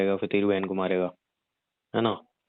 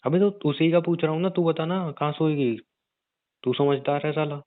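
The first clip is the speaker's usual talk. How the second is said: irritated higher-pitched retort; exasperated; 6 semitones higher than usual. Acted. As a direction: throw the question back at her, fed up. Exasperated at her question.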